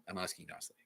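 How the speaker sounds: soft, gentle request; nicely. Real, not acted. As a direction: ask it softly, kindly. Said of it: "And also not nicely".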